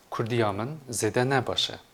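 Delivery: normal talking voice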